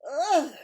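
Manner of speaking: loud high disgusted ugh; loud spluttering ugh; spluttered ugh. Acted. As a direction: recoil from a foul taste. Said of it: "she spluttered".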